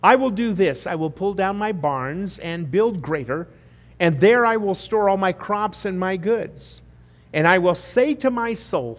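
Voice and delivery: a boastful rich farmer planning bigger barns; rich fool quoted. Acted. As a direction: proclaim it like the greedy farmer. Preacher voicing the rich fool.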